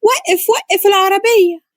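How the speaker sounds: high, drawn-out cry of alarm; screaming at driver. A narrator's reading. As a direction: cry out urgently, pitch way up. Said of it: "اتكلمت بصوت عالي وهي بتصرخ في السواق".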